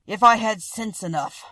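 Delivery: a gentle monster, loud and earnest; Beast voice. A narrator's reading.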